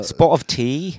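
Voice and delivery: high, loud posh British question; mock British. Joking about a British read, cohost offers a British spot of tea. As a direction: toss it off like a tea-loving Brit. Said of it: "with a British accent".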